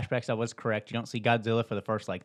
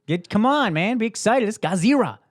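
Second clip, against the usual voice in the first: high, loud mock excitement; hyped up; 11 semitones higher, 6 dB louder than usual. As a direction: pump it up, shout with energy. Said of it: "Need some more energy".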